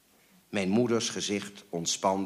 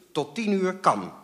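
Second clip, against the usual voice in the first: much higher, decided counter; decisive; 10 semitones higher, 3 dB louder than usual. A narrator's reading. Mother ruling on how late to call, decisive. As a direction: settle it firmly, pitched high. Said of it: "besliste mijn moeder".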